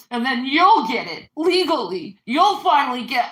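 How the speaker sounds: ordinary talk, nothing marked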